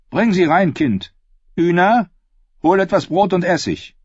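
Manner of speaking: giving orders. Acted. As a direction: call out instructions to the household. Woman orders the girls about. (laut und hoch angeordnet)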